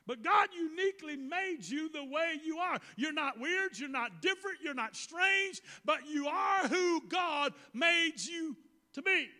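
high, ringing preacher proclamation; fervent preaching. Real, not acted. Preacher reassuring listeners they are not strange, fervent preaching. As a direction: proclaim it high, slowing on the final phrase.

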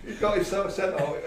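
laughing. Real, not acted. Repeats punchline laughing.

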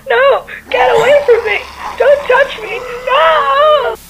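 high, loud frantic screams; terrified screaming. Acted. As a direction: scream as they grab you. Attacked by creatures.

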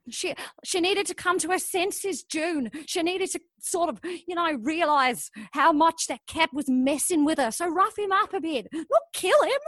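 high, exasperated self-defence; indignant protest. Acted. Justifies herself to her accomplice, indignant protest. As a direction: climb to a squeaky high pitch on the last words.

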